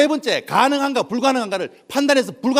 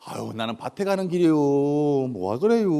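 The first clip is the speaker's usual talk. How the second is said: slow, stretched Chungcheong drawl; easygoing Chungcheong dialect; Chungcheong countrywoman; 5 semitones lower, slower than usual. Acted. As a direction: drag the vowels like an unhurried Chungcheong auntie. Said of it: "저 충청도 아줌마가".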